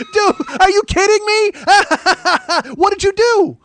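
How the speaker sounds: high, loud cackling disbelief; mocking laughter. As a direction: imitate friends howling with laughter at him. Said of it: "they're just laughing".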